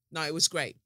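usual speaking voice

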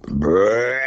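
one short rolled grunt; imitated deer grunt. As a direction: mimic a buck grunt call. Imitates his grunt call to the buck.

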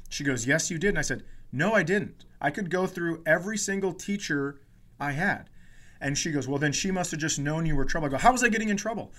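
ordinary talk, nothing marked

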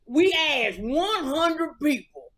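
hammed-up country game-show host; Steve Harvey impression. Co-host mimics the host quizzing Family Feud contestants, Steve Harvey impression. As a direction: stretch the question out, then shout the catchphrase. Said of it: "That ain't what Steve sound like".

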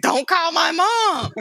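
mock plea to the teacher; pleading kid. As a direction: beg like a scared schoolgirl. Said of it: "I was like".

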